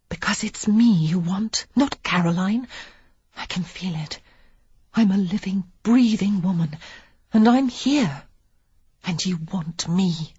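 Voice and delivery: seductive woman. A narrator's reading. Lily seducing, continuing whisper.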